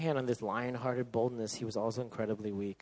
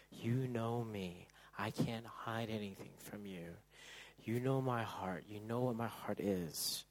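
soft confession; 10 dB quieter than usual. Acted.

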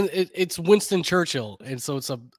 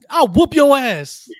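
loud, high, slow tough-guy threat; mock threat; 10 semitones higher, 10 dB louder than usual. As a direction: ham up a fight promo threat.

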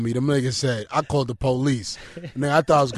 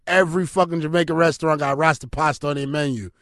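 normal talking voice

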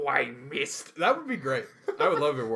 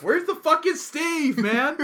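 high, loud laughing yell; laughing outburst; 7 dB louder, slower than usual. Real, not acted. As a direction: yell it while cracking up.

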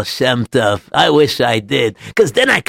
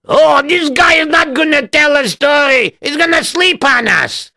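high, strained heckle; complaining audience member; 4 dB louder than usual. Acted. Listener complains about the sleepy storyteller, high-pitched grumbling. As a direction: grumble loudly in a high put-on voice.

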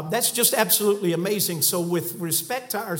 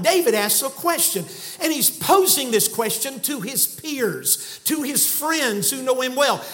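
preaching at full force; 4 semitones higher than usual. Acted. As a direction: preach it hard to the room. Preaching the text.

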